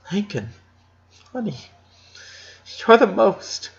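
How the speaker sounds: mother straining to sound calm for her son; mother holding back tears. A narrator's reading. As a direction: force the words out gently through grief. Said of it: "doing an enormous effort to modulate her words".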